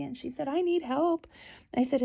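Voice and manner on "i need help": put-on frazzled babysitter. Babysitter voiced in story.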